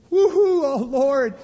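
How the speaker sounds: a cheerful giver cheering as he gives it all; hilarious giver. Retold or imitated. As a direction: throw your money at God with a whoop. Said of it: "Hilarious giver".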